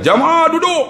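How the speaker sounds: high, drawn-out call to a group. Retold or imitated. Ustaz telling pilgrims to sit, high calling out.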